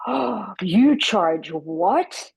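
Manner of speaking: slow, drawn-out disbelief; mock incredulous teller. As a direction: imitate the teller's scandalised tone. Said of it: "And she goes".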